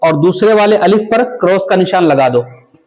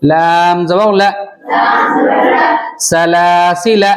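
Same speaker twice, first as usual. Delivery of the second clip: slow chanted spelling of letters and vowels; Quran spelling drill; much slower than usual. Shown as a demonstration. Teacher chanting the spelling for young pupils.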